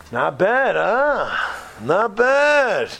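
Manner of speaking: drawn-out, rising-and-falling approval; impressed admiration. Acted. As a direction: nudge him for agreement, pitch swooping high. Reporter's acted admiration after concert.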